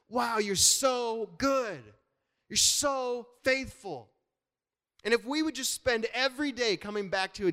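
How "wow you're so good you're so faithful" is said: drawn-out, louder words of worship to God; slow adoring prayer voice; slow heartfelt praise. Retold or imitated. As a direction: linger on each word like an awed prayer.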